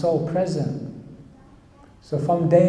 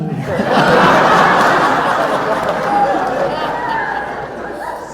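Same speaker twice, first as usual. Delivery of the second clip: loud, high burst of laughter; 4 dB louder than usual. Real, not acted. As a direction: crack up at your own story. Big laugh at the irony.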